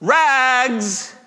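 high, ringing street vendor's cry; sing-out tenor hawker's call; clear calling out. A narrator's reading. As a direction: call it out high and long down the alley. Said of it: "calling in a clear tenor voice".

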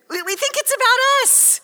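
high, loud and ringing; emphatic outburst. Acted. Preacher to congregation, crying out loud about self-focus. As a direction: call it out to the whole room.

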